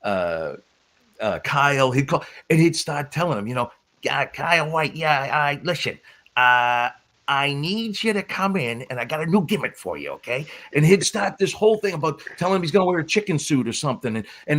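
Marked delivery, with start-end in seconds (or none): 4.0-10.5 slurred, halting phone pitch in a put-on voice; Sparta impression. Wrestler prank-calling Kyle as Sparta, impersonation. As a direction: imitate a wrestling promoter pitching a gimmick by phone. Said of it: "do an impersonation of Sparta".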